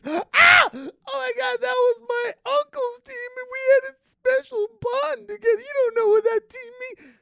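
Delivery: very high, loud hammed-up wail; mock wailing fan. Mocking emotional sports fans, high loud mock wail. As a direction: cry over the uncle's team like a tragedy.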